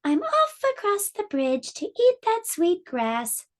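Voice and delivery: high little goat. Acted. Littlest billy goat sets off across the bridge, high and small. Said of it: "the littlest billy goat Gruff said".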